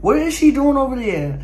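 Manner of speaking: ordinary talk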